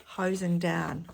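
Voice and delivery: plain reading voice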